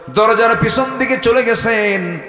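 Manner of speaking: high, loud, drawn-out preaching; full-force preaching. A narrator's reading. Preacher telling how Omar went round the door, high drawn-out chant. Sermon story rises into chant.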